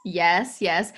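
ordinary talk

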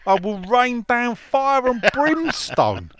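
slow, high, hammed-up threat; mock wrath. Podcaster reads out a listener's rant to co-hosts, mock wrath. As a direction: declaim it slowly, like mock doom.

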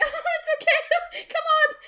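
fake-merry through the pain; forced jolly. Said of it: "nice, jolly, merry".

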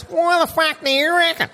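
high, muffled voice as if through a snorkel; Snorkel impression. Mate imitating Snorkel from the port, high snorkel-muffled voice. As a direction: talk like a man with a snorkel in his mouth. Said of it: "sounds like he's talking through a snorkel".

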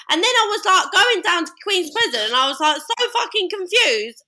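put-on suddenly un-posh accent, high and loud; loud, high-pitched mock accent, anything but posh; Chloe. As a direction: mimic an accent slipping far from posh, pitched right up. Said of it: "Her accent morphs into really different things".

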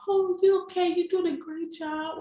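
high cooing imitation of mom; mocking mom. Daughter mimicking her mother cuddling her, high sugary coo.

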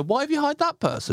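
high-pitched indignant questioning; mock complaint. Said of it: "put on an accent for a character and go".

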